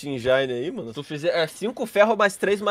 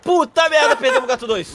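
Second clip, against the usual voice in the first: alarmed outburst; 8 semitones higher, 4 dB louder than usual. Real, not acted. Teammate just lost in fight.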